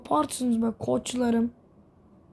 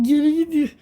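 surprised; 4 semitones higher, 6 dB louder than usual. Real, not acted. Vehicle reverses.

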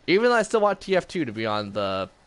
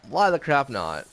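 usual speaking voice

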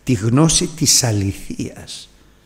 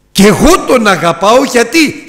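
ordinary talk

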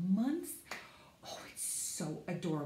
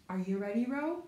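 ordinary talk

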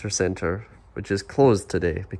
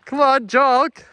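high, loud call to keep jogging; urging a partner on, loud and high; 6 dB louder, slower than usual. Real, not acted. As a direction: shout it back over your shoulder. Calling to partner while jogging.

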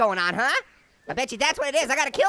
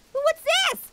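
high curious. Real, not acted. Finding item in game.

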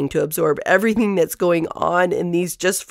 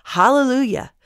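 crying out; 6 semitones higher than usual. Acted. Multitude in heaven praising God, crying out. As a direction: proclaim it as a heavenly crowd would, without full shouting. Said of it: "in heaven crying out".

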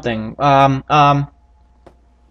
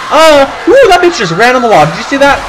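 shocked yelling; 11 semitones higher, 8 dB louder than usual. Real, not acted. Zombie ran up the wall.

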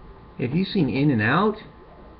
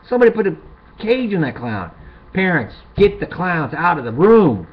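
much louder and higher, yelling at parents; loud exasperated tirade; shouted rant; 10 semitones higher, 8 dB louder than usual. Real, not acted. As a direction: rant it like an outraged warning. Ranting warning to parents.

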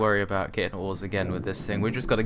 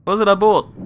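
excited yell; 10 semitones higher, 10 dB louder, faster than usual. Real, not acted. High loud.

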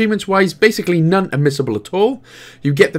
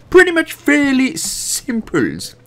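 silly catchphrase voice; 11 semitones higher, slower than usual. Pretend. Meerkat catchphrase joke.

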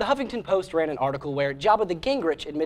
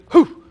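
exhaled whew; 12 dB louder than usual. Acted. After rally shout.

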